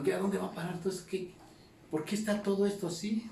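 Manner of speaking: high-pitched imitation of bewilderment; perplejos imitados. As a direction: act out a baffled crowd asking one another. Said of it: "Y perplejos, como diciendo". (imitando perplejidad con voz más aguda)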